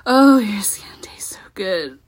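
breathy whisper, lips close to the mic; sultry whisper. Acted. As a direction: whisper it like you're tasting them. Intimate roleplay, savouring partner.

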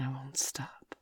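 soft whisper, barely voiced. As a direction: breathe it as a whisper. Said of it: "I'll whisper into your ear".

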